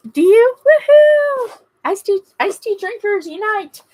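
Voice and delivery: high, loud whoop; shrieking woohoo then rally cry; excited cheer. Real, not acted. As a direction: celebrate a fellow tea drinker. Viewer also drinks iced tea.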